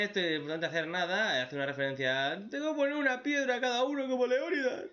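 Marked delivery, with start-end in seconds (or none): 2.5-4.9 higher, lightly put-on voice; a touch of a hammy anime hero; mock character quote. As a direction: toss off a silly character line. Parodying a character's reference.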